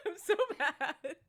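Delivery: slow, laughing mock complaint. Real, not acted. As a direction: drag it out through laughter. Laughing after ranting.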